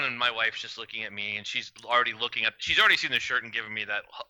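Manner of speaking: ordinary talk, nothing marked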